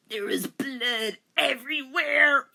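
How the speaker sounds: slow, high wail; hammed-up horror; mock horrified cry. Denmark finding bleeding Rachel, horrified.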